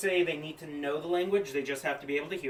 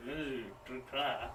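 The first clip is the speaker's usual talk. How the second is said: a lightly voiced creature, quieter as it trails; puzzled guttural mumble fading out; questioning creature gibberish; 3 semitones lower, 6 dB quieter than usual. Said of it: "and they go".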